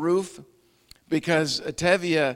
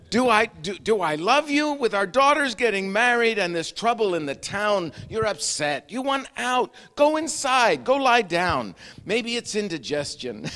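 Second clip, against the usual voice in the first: lightly voiced wife, a touch louder; Golde's voice; 6 semitones higher, 5 dB louder than usual. Golde scolding Tevye, mild exasperated wife voice. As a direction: play the exasperated wife, brushing him off.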